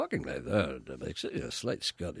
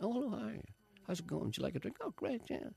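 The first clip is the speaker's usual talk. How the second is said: higher, softer chirpy host greeting; early Graham Norton; 8 semitones higher, 8 dB quieter than usual. Retold or imitated. Mimicking young Graham Norton chatting to a guest, softer and higher. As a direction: play the eager young chat-show host. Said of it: "that kind of Graham Norton".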